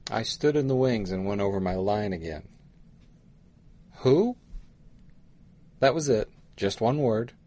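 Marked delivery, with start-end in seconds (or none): 4.0-4.4 higher, rising "who"; a short puzzled query; confused question. A narrator's reading. Stand-in actor rehearsing his one line, confused. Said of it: "while looking confused".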